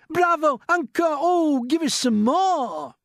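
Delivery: high, loud cheer for an encore; excited shout. A narrator's reading. Miss Spider cheering the grasshopper's music, shouted. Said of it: "Miss Spider stood up and shouted".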